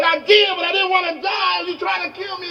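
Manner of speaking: very high-pitched frantic protest; acted panicky outburst; outraged shouting. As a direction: shout back as if just nearly drowned. Near-drowned man protests to guru.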